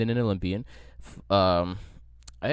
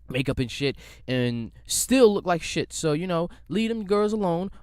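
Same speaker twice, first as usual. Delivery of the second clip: heated rant; 9 semitones higher, 5 dB louder than usual. Real, not acted. Ranting at hair critics.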